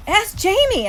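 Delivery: high, loud insistent voice; urgent appeal. A narrator's reading. Son tells mom to ask his brother, urgent. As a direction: urge it as proof. Boy calls on brother to confirm.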